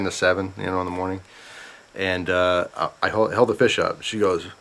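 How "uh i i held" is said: ordinary talk, nothing marked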